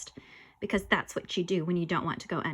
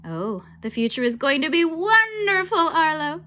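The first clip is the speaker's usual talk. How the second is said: higher, louder gushing assurance; assuring; 6 semitones higher, 5 dB louder than usual. A narrator's reading. Ghost promises the boy a future, gushing assurance. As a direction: gush it with sing-song swoops. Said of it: "assured me".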